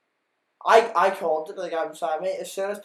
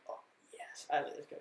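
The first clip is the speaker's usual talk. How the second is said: whispering; 10 dB quieter than usual. Retold or imitated. Reacting in a retold movie moment.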